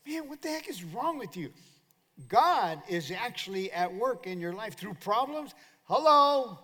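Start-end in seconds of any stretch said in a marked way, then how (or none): 0.1-1.5 high-pitched incredulous challenge; mock exasperation. 5.8-6.6 loud, high wake-up hello; calling out. Real, not acted.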